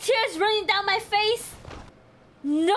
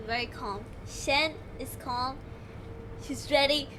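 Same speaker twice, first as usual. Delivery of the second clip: softer, low self-calming words; self-soothing; 7 semitones lower, 7 dB quieter than usual. Real, not acted. Gamer calming herself before a retry, soft. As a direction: talk yourself down quietly. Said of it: "I am very calm".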